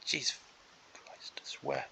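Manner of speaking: whispered curse under the breath; exasperated whisper of a curse; swearing under breath. Real, not acted. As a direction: mutter it to yourself so no one hears. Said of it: "I really am swearing to my breath".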